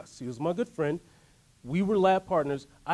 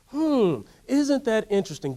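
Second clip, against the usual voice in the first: curious musing; 6 semitones higher, 4 dB louder than usual. Shown as a demonstration. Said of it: "Say, "Hmm".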